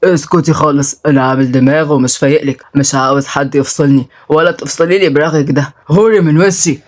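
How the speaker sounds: put-on low, dismissive drunk; surly drunk man. Drunk man brushes off the woman scolding him, surly. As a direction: shush her and grumble low.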